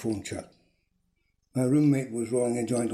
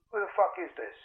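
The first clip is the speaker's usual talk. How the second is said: higher, thin telephone-line voice; tinny, irked caller, voice lifted; annoyed phone caller; 7 semitones higher, 3 dB quieter than usual. A narrator's reading. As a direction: demand an answer down the line, pitched up.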